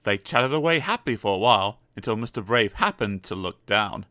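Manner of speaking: usual speaking voice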